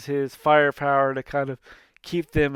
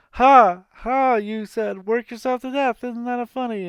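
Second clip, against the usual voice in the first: higher laughing Zeus voice; mock hearty laugh; 8 semitones higher than usual. Zeus laughing at his own joke, high and jolly. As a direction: chuckle through the pun.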